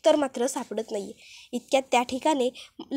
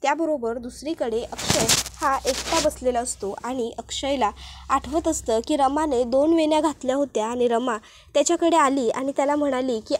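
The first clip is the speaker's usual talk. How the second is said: usual speaking voice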